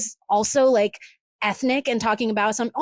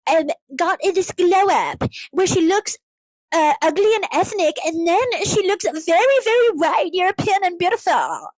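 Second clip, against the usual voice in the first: put-on dumb influencer accent; 5 semitones higher than usual. Influencer showing off a makeover, dumb-accent parody. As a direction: stretch it out like a brain-rot influencer. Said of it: "dumb ass accent".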